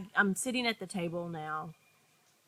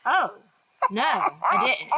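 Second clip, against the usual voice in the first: mock denial; 8 semitones higher, 6 dB louder, slower than usual. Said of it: "And I was like".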